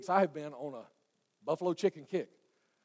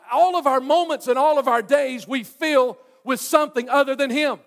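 louder, higher, driving sermon voice; forceful preaching; 7 semitones higher, 10 dB louder than usual. Real, not acted. Preacher telling a congregation what fills their appetite, forceful. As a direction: drive the point home to the room.

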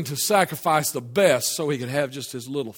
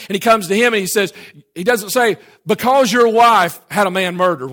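loud, raised preacher's voice; full-force preaching; 5 semitones higher, 9 dB louder than usual. Real, not acted. Preacher telling how God confronts Ahab over his wife's deed, full-force preaching. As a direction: drive the charge home from the pulpit.

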